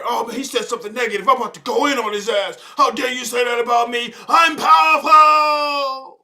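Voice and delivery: building to a hammed-up loud cry; mock outrage. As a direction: work up to a melodramatic shout. Mocking offended spooky person.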